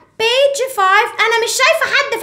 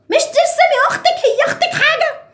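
higher, exasperated mom scolding; mock mom scolding; 7 semitones higher, faster than usual. Mom scolding her daughter over the drawing, mock higher scolding. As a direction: tell the kid off in a raised mom voice. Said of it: "مامي قعدت تزعق".

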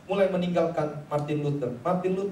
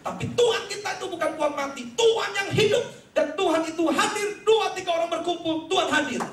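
preaching at full force; 11 semitones higher than usual. Acted. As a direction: whip the crowd up. Preacher proclaims God lives.

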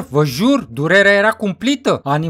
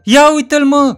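high, loud call-out; loud exclamation; 8 semitones higher, 6 dB louder than usual. Acted. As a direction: point him out loudly, voice jumping up. Shouting friend spots the narrator.

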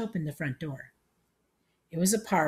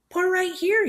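put-on very high, loud old-man voice; high, loud elderly command; rallying old man; 7 dB louder than usual. A narrator's reading. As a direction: pipe up eagerly as an old-timer. Old man rallies at kitten.

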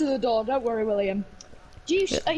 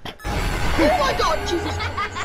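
startled high yelp; real fright, then a laugh; scared horror game streamer; 6 semitones higher, 4 dB louder, much slower than usual. Real, not acted.

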